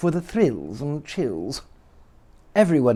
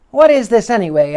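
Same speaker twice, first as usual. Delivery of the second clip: put-on high, loud child demand; demanding boy voice; 8 semitones higher, 7 dB louder than usual. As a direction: call it out like a startled boy. Boy confronts little man.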